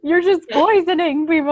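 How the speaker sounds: high, loud pretend complaint; mock accusation. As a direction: play an outraged parent.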